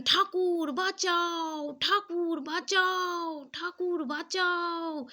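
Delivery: high, slow, drawn-out cries for help; retold desperate scream. Young mother screaming to Thakur for rescue as men break into her house, high drawn-out cries. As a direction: wail it out high and slow, begging to be saved. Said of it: "সেই মাটি চিৎকার করছেন".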